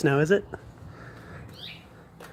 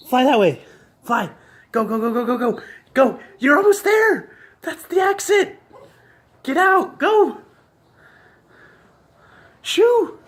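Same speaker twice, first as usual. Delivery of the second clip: urgent high shouting; 10 semitones higher, 5 dB louder than usual. Real, not acted. Shooing the bird out.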